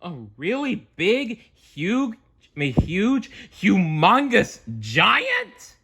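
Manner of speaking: high, amazed voice stretching out the size; high cartoon voice, slowly savouring humongous; high cartoon character voice. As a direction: marvel at how huge he is, high and drawn out. Character exaggerating giant size.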